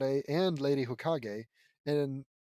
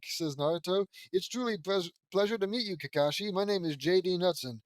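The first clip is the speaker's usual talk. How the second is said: plain reading voice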